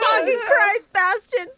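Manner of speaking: loud, high exasperated cry; outraged shout. Real, not acted. Fan reacting to Bastion's treatment, loud exasperated outcry. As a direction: blurt it out in loud disbelief.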